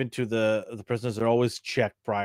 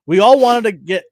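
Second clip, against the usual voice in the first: excited outburst; 10 semitones higher, 11 dB louder than usual. Real, not acted.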